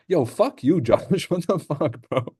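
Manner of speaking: mock-offended laugh at a viewer; laughing mock outrage. Real, not acted. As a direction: laugh and swear at Josh in fake outrage. Chat calls him cannon fodder.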